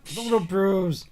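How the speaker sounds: mocking. Mocking Tina's dismissal.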